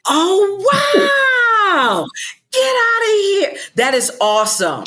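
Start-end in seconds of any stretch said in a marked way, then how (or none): 0.1-3.6 high, near-tearful disbelief; crying out in happy shock; choked-up delighted cry; overwhelmed joy. Real, not acted. Overwhelmed by the AI idea.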